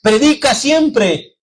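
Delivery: slow, high, emphatic quote; quoted maxim. Retold or imitated. Preacher quoting a saying to the congregation, slow and emphatic. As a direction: lift the saying up slowly for the flock. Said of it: "uno decía en una ocasión".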